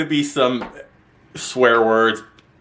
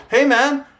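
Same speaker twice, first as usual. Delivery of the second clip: casual coworker; 12 semitones higher than usual. Retold or imitated. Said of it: "came in and said".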